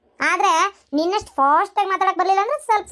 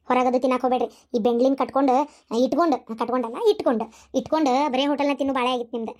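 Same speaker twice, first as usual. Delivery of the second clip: sweet coaxing; 6 semitones lower than usual. Acted. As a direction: coo to him to make the rival jealous. Wife sweet-talks husband to spite rival. (ಸವತಿಗೆ ಹೊಟ್ಟೆ ಉರಿಸಲು ಗಂಡನೊಂದಿಗೆ ಮೃದುವಾದ ಸಿಹಿ ಮಾತು)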